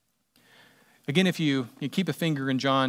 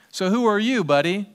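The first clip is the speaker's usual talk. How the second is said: high, louder taunt, a sneering buddy at the end; mocking challenge; 6 semitones higher, 5 dB louder than usual. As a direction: talk down to him like a cocky upstart. Leaders challenge Jesus mockingly.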